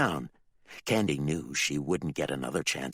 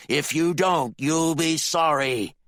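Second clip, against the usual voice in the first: louder, menacing order; dangerous growl; 10 semitones higher, 6 dB louder, slower than usual. Acted. Bill warns his son, dangerous growl. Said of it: "a dangerous growl in his voice".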